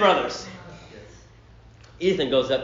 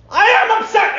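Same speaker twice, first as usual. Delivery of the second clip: high, loud mock-angry outburst; upset shout; 13 semitones higher, 5 dB louder than usual. Preacher plays son snapping at officer, indignant high shout.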